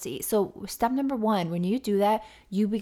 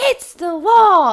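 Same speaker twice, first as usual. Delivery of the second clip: loud, high, slow mock shout; mock worker yell; 10 semitones higher, 13 dB louder, slower than usual. Store worker insisting to customers it's the law, hammed-up loud shout. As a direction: bark it like an officious worker. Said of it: "And he goes".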